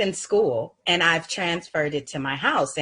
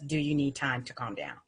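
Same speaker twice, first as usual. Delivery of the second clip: soft question; 3 semitones lower, 7 dB quieter than usual. Shown as a demonstration. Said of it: "another sentence".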